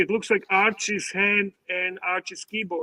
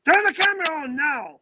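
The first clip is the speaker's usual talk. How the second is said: hammed-up boss barking orders, louder and higher; mock angry boss; 5 semitones higher, 5 dB louder than usual. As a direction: play an outraged boss bossing Archie. Mock boss yelling at Archie.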